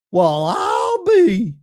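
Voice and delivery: drawn-out country amazement; Gomer Pyle impression. Streamer doing Gomer Pyle after reading the result, slow drawl. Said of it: "That's my best Gomer Pyle voice".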